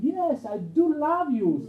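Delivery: loud, high, drawn-out "do love"; upset, insistent Peter. Acted. Peter upset at being asked again, loudly insistent "do". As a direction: cry it out slowly, insisting on "do". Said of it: "And Peter will be upset".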